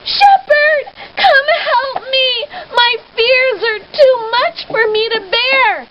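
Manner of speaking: high, loud tearful cry for help; frightened girl crying out to the shepherd; yelling for help. Acted. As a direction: call out desperately through tears. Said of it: "She yelled out".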